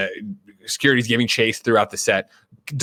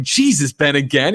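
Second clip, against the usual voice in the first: raised-pitch mock exasperation; Matt Damon; 4 semitones higher, 4 dB louder than usual. Co-star reacting to Ben on set, mildly exasperated. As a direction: play a fed-up co-star, lightly. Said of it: "Matt Damon's like".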